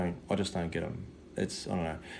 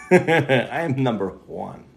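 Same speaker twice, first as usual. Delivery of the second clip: slow laughing boast; 5 semitones higher, 7 dB louder, slower than usual. Real, not acted.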